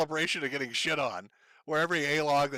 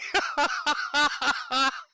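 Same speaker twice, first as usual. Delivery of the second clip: high-pitched cackling laughter; hard laugh; 11 semitones higher than usual. Real, not acted. Host after mocking Boogie, laughing hard. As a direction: burst out laughing at your own joke.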